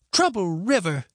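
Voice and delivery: put-on scornful old granny; disgusted. A narrator's reading. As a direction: sneer the name with disgust. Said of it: "Her disgust was plain".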